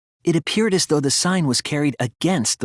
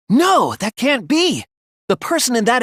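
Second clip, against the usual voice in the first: shocked disbelief; 10 semitones higher, 3 dB louder than usual. Acted. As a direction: recoil at the truth he can't accept. Shocked realization in class trial.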